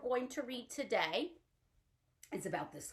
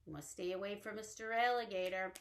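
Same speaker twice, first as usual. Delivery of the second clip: ordinary talk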